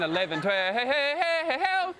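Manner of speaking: rising singsong count into hey; Sesame Street count. Retold or imitated. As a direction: count like a kids' TV show. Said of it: "watching Sesame Street".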